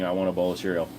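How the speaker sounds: ordinary talk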